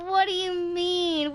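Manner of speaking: usual speaking voice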